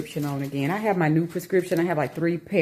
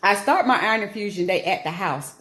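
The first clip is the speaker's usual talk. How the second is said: usual speaking voice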